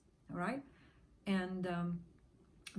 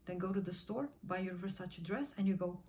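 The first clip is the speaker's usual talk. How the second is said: ordinary talk, nothing marked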